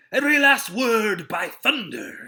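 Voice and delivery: loud, high and defiant; defiant shout. A narrator's reading. Silver shouting his answer at the captain, defiant. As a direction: throw it back hard.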